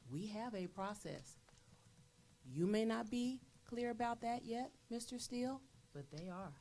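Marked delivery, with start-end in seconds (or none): 2.5-6.5 pointed reply. Real, not acted. Rebutting a skeptical board member.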